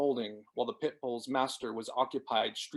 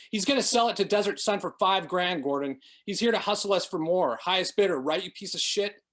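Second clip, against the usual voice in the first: angry accusation; 5 semitones higher, 4 dB louder than usual. Acted. Vicky accusing Tyler angrily.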